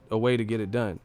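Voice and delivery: ordinary talk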